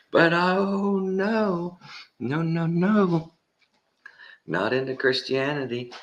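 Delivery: hammed-up dismay in a high-pitched voice; mocking outrage. Mocking the interviewees' double standard.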